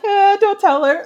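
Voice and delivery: mock-panicked blurt, high and loud; stressed reaction. Viewer pleading with the characters to keep the secret, mock-alarmed. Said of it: "I'm like".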